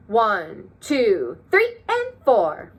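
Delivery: three, and four sung out high and slow. Shown as a demonstration. Teacher and class counting, eighth notes in a high silly voice. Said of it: "highlight the eighth notes".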